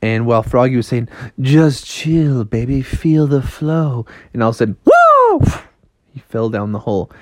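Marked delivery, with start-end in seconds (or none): 1.4-4.0 lightly voiced mellow skater dude; a hint of chilled-out cool; laid-back frog. 4.8-5.4 loud, high whoa; high-pitched shout of alarm; startled falling cry. Acted. Froggy falls in the hole.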